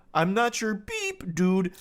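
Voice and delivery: slow, high mock reading with held beeps; ridiculing a self-censored rant. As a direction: bleep out each curse in a high tone. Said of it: "He's literally beeping himself with a sensor".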